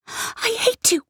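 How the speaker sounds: put-on very high child's voice; child's cry. A narrator's reading. Girl screaming hatred at her mother.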